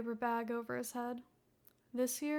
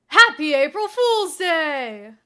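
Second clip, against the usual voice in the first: loud, drawn-out surprise greeting; gleeful shout; 4 semitones higher, 17 dB louder, slower than usual. Acted. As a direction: burst out from hiding and shout it. Masked prankster jumps out shouting.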